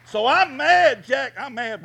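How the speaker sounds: loud, high, slow cry of anger; shouted mad. Real, not acted. Preacher shouting to his church that he's mad. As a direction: belt it out to the congregation.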